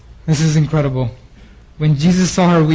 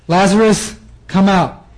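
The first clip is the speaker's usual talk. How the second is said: calling Lazarus out, raised and higher; loud call; 4 semitones higher, 3 dB louder, slower than usual. Retold or imitated. Jesus calling Lazarus out of the tomb, loud call. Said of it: "he cried out with a loud voice".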